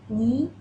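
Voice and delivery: "ni" with a rising second tone. Shown as a demonstration. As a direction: lift "ni" upward. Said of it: "second tone".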